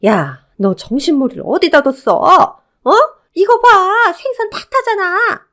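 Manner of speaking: high, loud scolding outburst; fiery anger. Acted. Mother-in-law yelling at elder daughter-in-law over burnt fish, furious. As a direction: explode at her, pitch leaping up into a shriek. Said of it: "불같이 화를 내는 겁니다".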